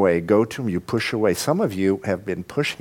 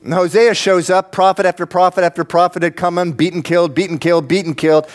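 raised pitch, driving repetitions; emphatic preaching; 7 semitones higher, 3 dB louder, faster than usual. Real, not acted. Preacher recounts prophet after prophet killed, emphatic. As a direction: hammer out each repeated phrase.

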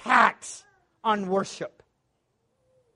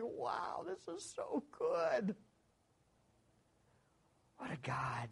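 softly breathed wonder, slowing down; hushed awe; 12 dB quieter, slower than usual. Real, not acted. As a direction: whisper your amazement at the psalm. Quiet awe at the psalm.

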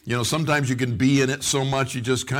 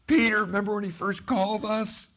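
excited reminder; 7 semitones higher, 3 dB quieter than usual. Retold or imitated. Disciple prompting Peter in retold story.

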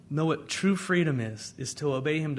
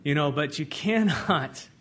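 usual speaking voice

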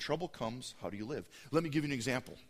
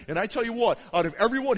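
ordinary talk, nothing marked

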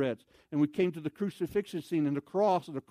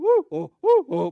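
high and loud alternating yelps; comic high whoops of alarm; back-and-forth whoas; 12 semitones higher, 13 dB louder than usual. Retold or imitated. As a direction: trade startled whoas like two frightened people. Trading startled cries back and forth.

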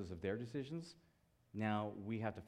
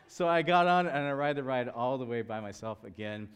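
normal talking voice

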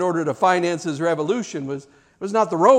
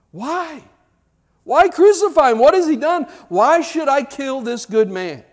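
high, loud pleading why; insistent protest; 8 semitones higher, 5 dB louder than usual. Retold or imitated. Pilate arguing with the crowd, loud insistent protest. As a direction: push back hard against the crowd. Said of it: "Pilate insists".

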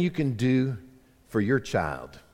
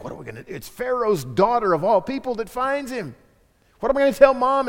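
mock panic; 8 semitones higher than usual. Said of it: "Miriam having a panic attack".

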